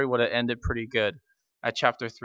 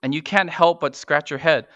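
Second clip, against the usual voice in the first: normal talking voice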